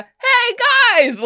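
high, sing-out greeting; calling out. Acted. Cassie hails the group from the crowd, calling. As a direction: hail friends over a crowd in a high voice. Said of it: "you hear, like".

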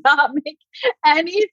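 stunned, giggling who-are-you; shocked host. Retold or imitated. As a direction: react like a host caught off guard. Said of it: "And they're like".

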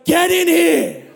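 high, slow demanding call; neighbour's demand. Retold or imitated. Neighbour ripping open the fence, slow demanding call. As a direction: drag out a bossy order. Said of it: "and he goes".

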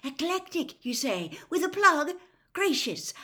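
high, fascinated wonder; excited. A narrator's reading. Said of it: "Mister Weasley's voice excitedly".

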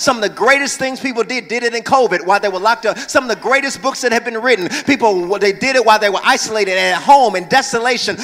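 loud, rising sermon climax; preaching at full force. Acted. Pastor urging the church to create, preaching loud. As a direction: drive it home to the congregation.

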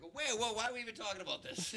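high-pitched, flustered protest; mock alarm. Girlfriend balks at living over the garage, mock alarm. Retold panic at parents' house plans.

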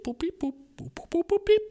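high beeping noises; robot beeps. Retold or imitated. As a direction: beep like a machine booting. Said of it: "hörst du so ein Piep".

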